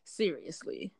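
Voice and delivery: ordinary delivery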